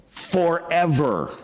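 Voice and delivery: forever drawn out slowly and leaned on; stress on forever. Shown as a demonstration. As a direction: stretch out forever to make it land. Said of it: "I emphasize that word there".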